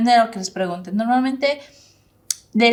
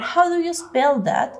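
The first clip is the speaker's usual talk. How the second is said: high, slowed "How do you spell that?"; demo question; 8 semitones higher, slower than usual. Shown as a demonstration. Teacher shows the class, high slow question. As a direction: lift it high like a model question. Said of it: "cómo podríamos deletrear".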